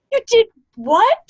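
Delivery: high, loud disbelief; shocked. Retold or imitated. Roommate hearing about the toothbrush, loud and shocked. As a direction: gasp it out in shock.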